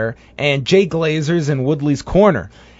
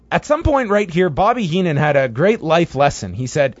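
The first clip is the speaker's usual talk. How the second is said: ordinary talk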